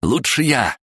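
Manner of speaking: high-pitched eager cry; urgent interjection. Acted. Blue Cloak taking over the shot, calling urgently.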